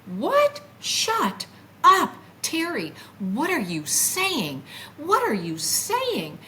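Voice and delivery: high, drawn-out retold disbelief; shocked self-scolding. Telling herself off for begging to leave, incredulous. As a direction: scold yourself in stretched, high-pitched astonishment.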